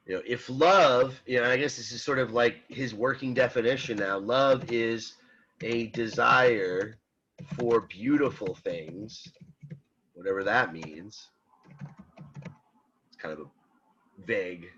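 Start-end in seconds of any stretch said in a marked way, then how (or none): 5.6-9.3 a desire for beautiful things, drawn out slowly; slow down and lean on beautiful; slow emphasis. Shown as a demonstration. Lecturer underlining a key definition, slowly. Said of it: "I'll put beautiful in all caps".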